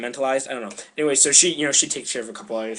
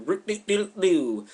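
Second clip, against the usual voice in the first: high, slow sing-song doo-doo sound; playful high nonsense syllables; busy doodling noise; 7 semitones higher, slower than usual. Retold or imitated. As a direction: hum a little tinkering noise. God busily shaping mud.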